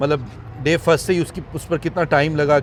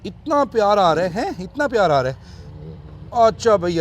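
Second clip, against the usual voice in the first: higher, louder doting voice; higher gushing over the cub; 6 semitones higher, 3 dB louder than usual. Real, not acted.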